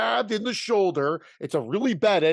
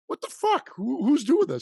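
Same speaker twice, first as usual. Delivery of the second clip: shocked outburst; 8 semitones higher than usual. Retold or imitated. Retold outraged reaction.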